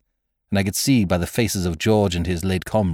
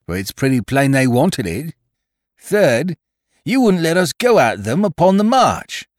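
higher-pitched, indignant mutineer; George; 8 semitones higher, 6 dB louder than usual. Acted. Mutineer listing grievances against the captain, heated indignation. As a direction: count off each charge as an accusation.

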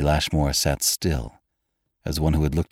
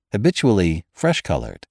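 plain reading voice

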